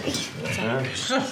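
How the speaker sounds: put-on drunk, thick-tongued and rambling; slurred speech. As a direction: slur every word like you're tipsy. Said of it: "舌头都大了".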